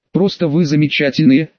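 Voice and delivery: ordinary delivery, nothing marked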